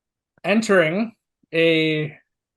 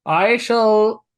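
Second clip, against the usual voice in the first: higher, louder priestly declaration; 7 semitones higher, 5 dB louder than usual. Acted. Priest answering the raised body, formal declaring voice. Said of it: "And the priest says".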